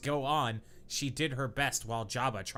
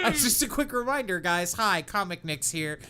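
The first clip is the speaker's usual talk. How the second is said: high excited aside; 7 semitones higher, 5 dB louder than usual. Real, not acted. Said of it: "aside to viewers".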